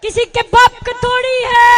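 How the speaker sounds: high, loud slogan shout; show of strength. Real, not acted. Speaker asking crowd to show strength, loud chant. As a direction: belt out the call to the crowd. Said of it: "So, show me the strength".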